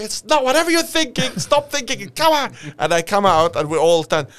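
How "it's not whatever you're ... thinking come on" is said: high-pitched impatient yelling; acted exasperation, high voice; exasperated sergeant. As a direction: order him out of hiding, fed up.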